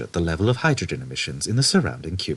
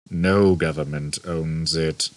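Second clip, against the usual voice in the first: ordinary delivery, nothing marked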